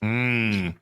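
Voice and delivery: normal talking voice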